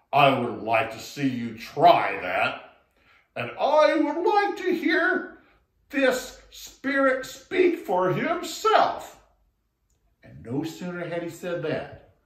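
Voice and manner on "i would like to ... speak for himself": loud, slow, mocking challenge; taunting elephant. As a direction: dare the frog to prove it.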